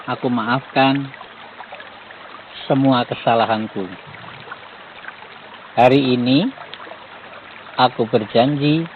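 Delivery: slow, paused affirmation for repeating; slow prayer recitation. Shown as a demonstration. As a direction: recite each phrase slowly for the group to echo. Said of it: "tirukanlah suara saya".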